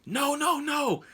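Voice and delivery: high-pitched panicked warning; alarmed. Retold or imitated. Instructor stopping the driver mid-turn, high alarm. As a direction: cry out to halt a turn.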